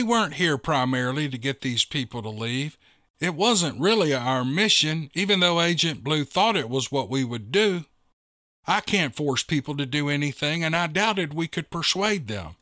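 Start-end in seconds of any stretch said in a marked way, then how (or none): none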